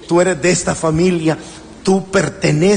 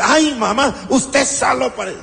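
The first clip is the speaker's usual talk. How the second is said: put-on higher, whiny teen protest; complaining child; 6 semitones higher than usual. Child protesting a scolding mother, whiny mock complaint. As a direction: mimic a kid groaning at mom. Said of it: "cuando te dice".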